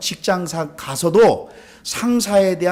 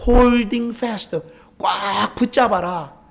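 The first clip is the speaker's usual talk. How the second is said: "holding fast" and "꽉" drawn out slowly; emphatic exhortation; 5 semitones higher, much slower than usual. Retold or imitated. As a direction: stretch "holding fast" high, then hold "꽉" long. Stressing the apostle's exhortation. ("꽉"을 길게 늘여 강조)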